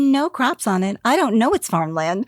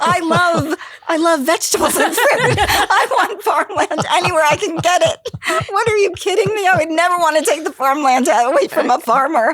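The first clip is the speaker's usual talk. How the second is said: high, loud, laughing overenthusiasm; mock gushing; 7 semitones higher, 4 dB louder than usual. Real, not acted. Guest jokingly overstates her love of farmland, gushing and laughing. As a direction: ham up your love of farmland.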